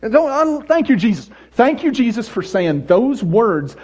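high, loud mock thanks; hammed-up gratitude. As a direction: gush with selfish relief. Mock self-serving joy.

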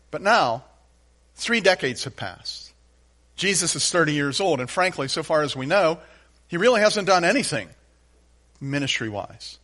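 usual speaking voice

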